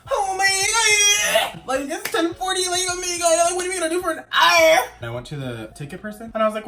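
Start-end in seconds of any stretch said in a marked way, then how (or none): 0.1-4.8 mock whiny date. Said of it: "and he was like".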